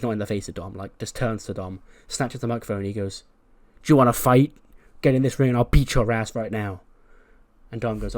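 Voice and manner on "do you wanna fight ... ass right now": loud furious threat, shouted; angry challenge. Retold or imitated. Breeze snatches the mic and threatens Dom, loud angry yell. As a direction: yell the challenge right in his face.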